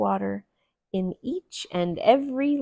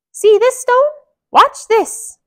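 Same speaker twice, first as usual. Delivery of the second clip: Mickey voice; 14 dB louder than usual. Acted. Mickey speaks.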